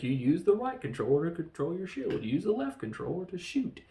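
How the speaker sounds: hammed-up rules explanation; imitated game explainer. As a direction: play the villain explaining his game rules. Acts out villain explaining video game.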